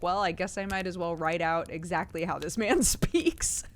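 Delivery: cracking up mid-line as a newspaper writer; giggling through an imagined reporter's thought; laughing reporter. Retold or imitated.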